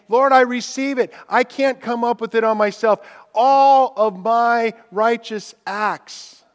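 high, loud, ringing declaration; fervent cry. Acted. Preacher voicing a believer crying out to God, loudly. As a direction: proclaim it like a testimony.

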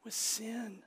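soft whispered compassion; compassionate Jesus. Pretend. Preacher voicing Jesus to a sinner, soft whispered compassion. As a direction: breathe it gently like a pitying saviour. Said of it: "Jesus looking at you and say".

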